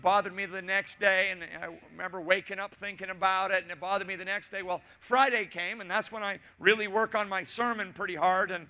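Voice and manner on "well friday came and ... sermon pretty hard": ordinary talk, nothing marked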